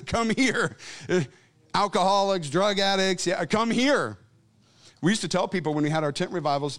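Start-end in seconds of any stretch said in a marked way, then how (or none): none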